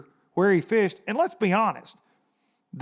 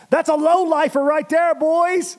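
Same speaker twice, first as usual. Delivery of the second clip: higher, hammed-up boast; mock show-off brag; 10 semitones higher, 4 dB louder than usual. Host tells angler what to shout on camera, hammed-up brag. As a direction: crow about the big fish, half joking.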